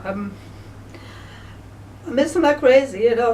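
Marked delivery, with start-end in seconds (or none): 2.1-3.3 fighting back tears over a lost horse; choked up. Real, not acted. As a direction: voice rising with grief, higher and louder. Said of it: "sorry, I'm emotional here now".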